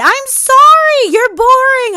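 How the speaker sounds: high, loud, slow fake apology; mocking dismissal. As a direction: sing it out with sarcastic pity. Dismissing a boring suitor.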